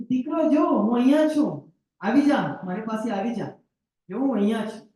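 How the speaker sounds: high, loud calling out; father calling son. Acted. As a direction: call the child over, relieved. Father calls lost son to come. (ઊંચા સાદે હરખથી બોલાવવું)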